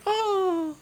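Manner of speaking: high breathy swooning sigh; faint like a damsel. Retold or imitated. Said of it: "with an open palm going".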